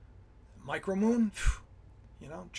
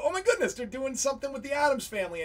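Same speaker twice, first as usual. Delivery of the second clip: retold excitement; 6 semitones higher, faster than usual. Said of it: "I go".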